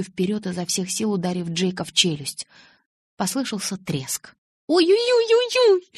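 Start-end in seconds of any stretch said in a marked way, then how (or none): 4.7-5.9 high, loud acted yelp of pain; pained wail. A narrator's reading. Bella hurt her hand punching Jacob, wailing. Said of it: "завопила я и запрыгала на месте".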